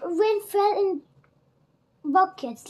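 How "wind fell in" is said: plain reading voice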